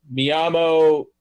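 loud, slow, stern, badly mangled Spanish. Player fakes Spanish to the gunners, slow, loud and stern. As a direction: bark it slowly like a tourist who thinks volume translates. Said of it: "just says it louder and more stern".

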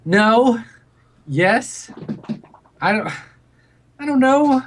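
put-on high, loud, rattled drunk answers; drunk crewmate. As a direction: blurt it out high and unsure. Drunk Connor acted, high and loud.

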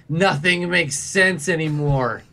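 put-on slow, drawn-out drunken lament; drunken despair. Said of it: "grabs the front of your shirt".

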